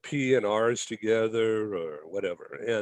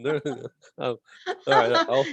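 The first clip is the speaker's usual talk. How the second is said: nervous laugh; 3 semitones higher, 3 dB louder, faster than usual. Real, not acted. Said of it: "it's my nervous thing".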